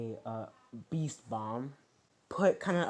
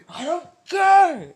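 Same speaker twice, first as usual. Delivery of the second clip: very high, loud, drawn-out shout; full-force acted yell of defiance; defiant scream; 16 dB louder, slower than usual. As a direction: blow up, stretching the words into a scream. Defiant outburst, escalated repeat.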